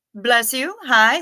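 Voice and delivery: ordinary delivery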